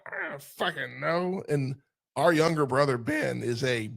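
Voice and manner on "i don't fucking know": slower, put-on shrugging grumble; lightly voiced indifferent man; mock bored boss. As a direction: brush it off like a tired executive. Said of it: "He's like".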